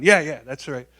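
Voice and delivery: loud, emphatic chorus of agreement voiced solo; imitating a roomful of backers; piling-on agreement. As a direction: chime in loudly as the whole board piling on. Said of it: "then everyone piled on".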